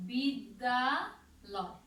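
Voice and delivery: very slow demo of বিদ্যালয়; drawn-out joined word. Shown as a demonstration. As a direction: slowly sound out বিদ্যালয় for the class. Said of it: "দেখো".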